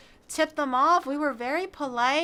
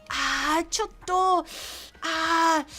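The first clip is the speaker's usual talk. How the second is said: higher, hesitant mock staff voice, trailing ahs; hesitant bar staff; 4 semitones higher, slower than usual. Imitating hesitant Japanese bar staff turning a foreigner away. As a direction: play the awkward bartender hedging politely. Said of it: "they were like".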